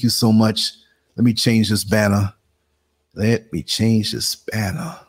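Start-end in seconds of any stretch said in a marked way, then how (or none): 3.1-5.0 slow, stretched-out words while busy; drawn out. Real, not acted. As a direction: draw each word out as you fiddle with the task. Same phrase repeated, drawn out.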